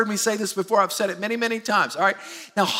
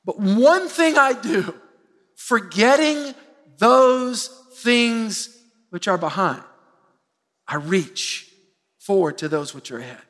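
slow, weighty reading of the verse; scripture reading; slower than usual. Acted. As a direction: lay each phrase down deliberately.

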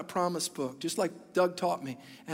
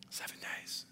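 hushed dramatic whisper; 15 dB quieter than usual. Acted. Pastor retelling Jericho to church, whispered for effect. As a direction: let it drop to a breath after the pause.

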